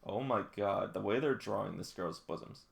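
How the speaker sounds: ordinary talk, nothing marked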